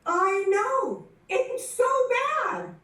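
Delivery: high playful admission; sheepish confession. Retold or imitated. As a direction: squeak it out like a guilty kid caught. And I looked at him and laughs I said.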